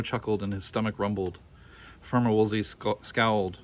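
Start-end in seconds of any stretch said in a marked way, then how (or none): none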